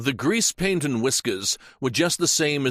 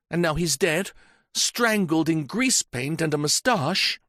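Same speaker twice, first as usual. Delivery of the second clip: higher, passionate and overwrought; defiant young woman in love; 8 semitones higher than usual. Acted. Janet to the inspector, defiantly declaring her love. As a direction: declare it proudly, nearly breaking.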